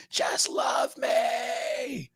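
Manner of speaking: high, very slow drawn-out cry; hammed-up anguished cry; mock desperate yell. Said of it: "punching himself in the face hella hard, going".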